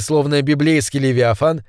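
plain reading voice

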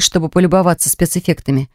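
usual speaking voice

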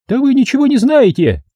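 high-pitched, outraged squeal; screeching woman. Acted. Mother demands policeman arrest the man, high screeching. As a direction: shriek a complaint to the police.

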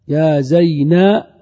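loud, drawn-out "zayna" for "زينب"; last letter dropped. Shown as a demonstration. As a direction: stretch "زينب" and leave it open on "a". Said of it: "ويبقى كل شيء بعد ذلك". (نطق "زينب" بصوت عالٍ مع فتح النون)

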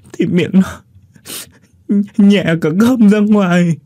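put-on high, halting child's voice choked with grief. A narrator's reading. Boy explains how his brother choked and died, choked up and high. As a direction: stammer it out like a tearful little boy. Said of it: "vừa nghẹn ngào vừa trả lời".

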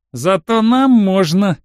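lightly acted predatory jeer; baring-teeth taunt. A narrator's reading. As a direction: draw it out with relish. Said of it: "ощерился негр".

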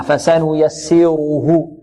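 slow فسنيسره with light seen and heavy, full raa. Shown as a demonstration. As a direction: thicken the ر in فسنيسره, keep the س thin. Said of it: "السين مرققة والراء مفخمة". (فسنيسره بسين مرققة وراء مفخمة)

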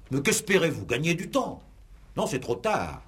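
raillerie montante, bien plus haut; sarcasme aigu. Acted. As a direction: se moquer du suspect qui gagne du temps. Juge raille l'accusé. (ton sarcastique et aigu)

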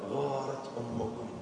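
"غارت" hushed and stretched; gentle excuse. Acted. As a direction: say it softly, forgiving her. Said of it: "ثم التمس لها عذرا".

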